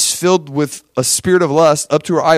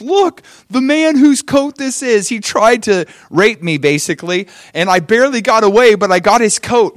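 higher-pitched, acted cry of accusation; mock distraught accuser; 6 semitones higher than usual. Wife accusing Joseph to the returning servants, mock distraught. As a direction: play the spurned woman pleading her false case. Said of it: "she starts crying and crying".

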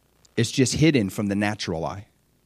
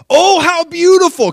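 much higher, louder gasp of wonder; gushing delight; 10 dB louder than usual. Acted. Jesus marveling at the bride, high and gushing. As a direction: swoon over her beauty.

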